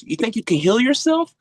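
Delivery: high, loud mock indignation; incredulous, high-pitched retold scolding; pissed-off doctor. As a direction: imitate an outraged doctor challenging a patient. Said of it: "She was pissed".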